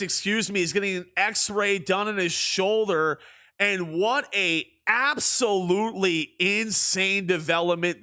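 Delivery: lively play-by-play call; sportscaster delivery; broadcaster voice. Acted. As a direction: call the game like a TV announcer. Live play-by-play commentary.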